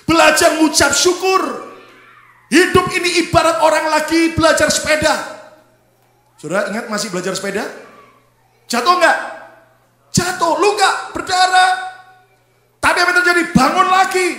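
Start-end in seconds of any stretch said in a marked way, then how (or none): 0.0-5.4 high, very loud exhortation; ringing pulpit delivery; preach at full force. Real, not acted. 8.7-14.3 high, very loud rallying cry; shouted, punchy exhortation; preach at full force. Real, not acted.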